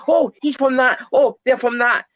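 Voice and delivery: high, bright-eyed surprise at a familiar face; spotting a known actor, mock delighted; excited recognition. Fan spotting guest actors, acts out excited recognition. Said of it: "It's like".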